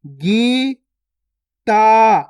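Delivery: Gita split as gee, pause, taa; syllable by syllable. Shown as a demonstration. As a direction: slowly sound out Gita in two held syllables. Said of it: "direct मात्रा का उच्चारण करके पढ़ेंगे". (गीता को तोड़कर, गी... ता)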